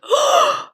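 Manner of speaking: high, sharp mock gasp; amazed gasp. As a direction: gasp like an awestruck younger self. Imagined younger self's amazed reaction.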